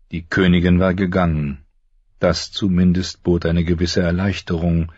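normal talking voice

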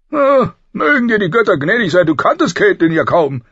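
high, loud groan into protest; exasperated king. A narrator's reading. King dismissing his friend's shame, exasperated. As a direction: brush the guilt aside loudly.

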